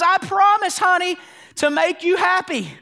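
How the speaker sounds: high hammed-up sappy vow; mock tearful groom vow. Pastor imitating a groom's wedding vow, high and sappy. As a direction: ham up the groom's teary promise. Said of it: "looks at her in the eyes and says".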